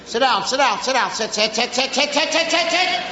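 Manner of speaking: rapid-fire repetition climbing in pitch; auctioneer patter. Acted. Parent ordering a child to sit, rattling like an auctioneer. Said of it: "Sound like a tobacco auctioneer".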